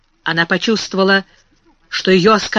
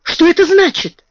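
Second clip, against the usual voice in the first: exclaiming; 9 semitones higher, 5 dB louder than usual. Acted. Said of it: "воскликнула она".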